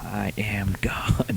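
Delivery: unvoiced, slow whisper; whispered mock proclamation; mock god whisper. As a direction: breathe it like a hushed deity.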